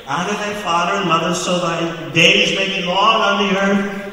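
put-on high, loud churchy little-kid voice; pious childish insistence. Boys insisting to their cousin on honesty, high pious mock insistence. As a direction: preach it like a righteous kid. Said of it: "we were convinced".

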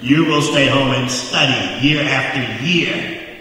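loud laying down the law; a parent's firm order, half in jest; mock stern command. Parents telling their son to stay home, loud mock command.

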